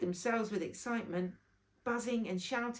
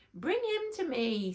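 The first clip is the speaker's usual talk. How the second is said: queen's command; 6 semitones higher, 3 dB louder than usual. A narrator's reading. Queen orders Bobby.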